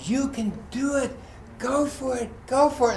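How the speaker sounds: high cheers, held back in volume at first; imitated cheering. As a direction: urge a runner on from the crowd. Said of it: "everyone there is cheering".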